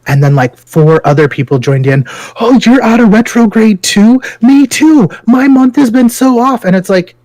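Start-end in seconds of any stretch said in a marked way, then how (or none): 2.4-6.6 much higher mock girly chatter; mocking coworkers. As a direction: parody their chirpy agreement.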